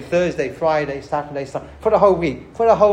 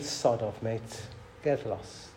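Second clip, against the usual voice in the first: dismissive brush-off; 8 semitones lower, 15 dB quieter, slower than usual. Shown as a demonstration. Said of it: "you've gotta talk like that".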